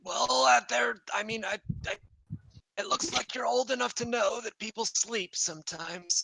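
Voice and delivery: high-pitched, hesitant creature voice; high little kobold, searching for words. Acted. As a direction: stammer a reply as a small kobold.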